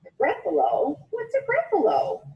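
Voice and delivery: baffled squeak of a question; puzzled owl. Acted.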